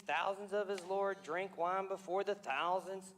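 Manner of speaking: ordinary talk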